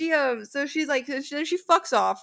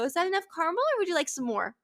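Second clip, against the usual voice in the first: mock sweet; 6 semitones higher, faster than usual. Sarcastic barista to rude customer.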